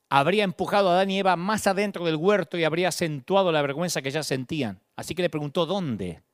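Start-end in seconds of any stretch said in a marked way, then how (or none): 5.6-6.2 gentle invitation. Acted. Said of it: "es una invitación a salir".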